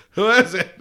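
put-on laughing drunk uncle voice; slurred, pushy, laughing. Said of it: "like a drunk uncle".

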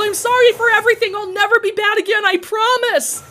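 high, loud and fast begging; frantic yelling plea. Acted. Garrett pleading for mercy, fast high frantic yell. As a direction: babble out promises in panic. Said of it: "Garrett yelled".

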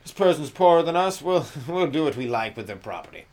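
lightly hammed-up rich snob; smug rich neighbour. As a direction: sneer it out like a snobby rich guy.

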